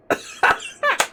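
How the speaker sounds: breathless laugh. Real, not acted. Laughing at own joke.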